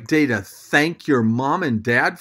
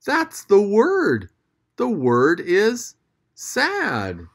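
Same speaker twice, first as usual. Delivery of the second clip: ordinary talk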